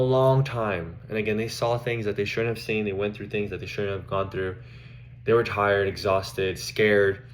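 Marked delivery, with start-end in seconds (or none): none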